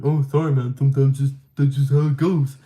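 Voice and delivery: loud, offhand imitation of an excuse; mock brush-off. Retelling the late instructor's brush-off.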